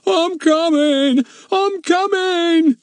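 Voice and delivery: high, slow ghostly moan, drawn out; horrible hollow moan. Acted. Ern scaring off the crooks, hollow ghostly moan. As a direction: moan like a ghost to scare them. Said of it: "moaned in a horrible, hollow voice".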